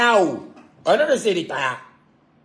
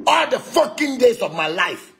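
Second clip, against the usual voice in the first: emphatic outburst; 7 semitones higher than usual. Real, not acted. Preaching his own blessing.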